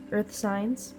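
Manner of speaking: ordinary talk, nothing marked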